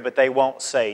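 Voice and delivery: ordinary talk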